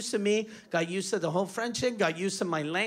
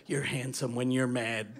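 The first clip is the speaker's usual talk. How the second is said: seductive flirt; 8 semitones lower than usual. Pretend. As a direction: melt the thug with a come-on. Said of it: "grab the biggest one by the face and go".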